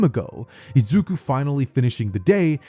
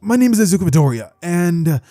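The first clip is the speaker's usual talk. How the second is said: higher, halting stammer with fillers; nervous public speaker; 4 semitones higher, 4 dB louder than usual. Acted. Boy at the mic before a gigantic crowd, nervous. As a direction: stumble haltingly, then blurt it louder.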